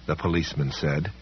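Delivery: ordinary delivery, nothing marked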